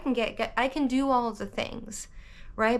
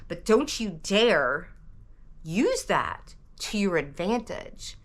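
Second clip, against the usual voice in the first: sarcastic mock scolding; slower than usual. Mocking critics of [name].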